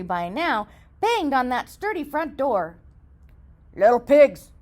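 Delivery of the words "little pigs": loud growly wolf demand; growling wolf. Acted. Wolf at the brick door, loud and growling. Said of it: "he growled".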